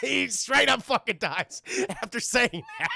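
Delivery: high, slow words breaking into laughter; cracking up while telling it; real helpless amusement through the words; laughing. Real, not acted. Cracking up at his own bit.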